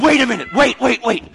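high, loud halt to a panicking crowd; acted frantic interruption; urgent calling out. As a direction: stop everyone with rapid, loud calls.

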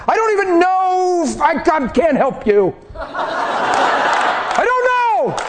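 high, slow giggling bewilderment; laughing. Real, not acted. Preacher overwhelmed with joy, giggling high. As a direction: laugh through the words, overcome.

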